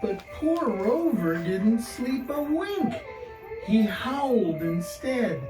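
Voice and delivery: plain reading voice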